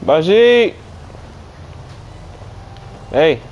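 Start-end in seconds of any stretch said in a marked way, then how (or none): 0.0-0.8 high, rising searching call. Acted. Driver searches for lost Baljeet, calling out. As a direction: call the name out into the distance.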